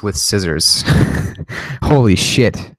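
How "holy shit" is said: normal talking voice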